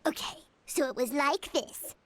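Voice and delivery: normal talking voice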